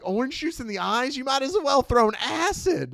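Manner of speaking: high, hammed-up victim's protest; mock outraged cry. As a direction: build to a loud, indignant yell. Retold victim's indignant cry.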